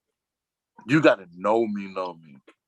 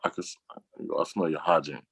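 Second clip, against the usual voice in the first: discreet hushed tip-off; 5 semitones lower, 7 dB quieter than usual. Retold or imitated. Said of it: "go tell that person, like".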